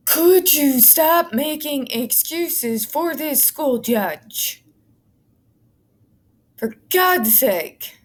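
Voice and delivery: weary disbelief at a judge's excuse; exasperated. Real, not acted. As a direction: drag it out, done with the excuses.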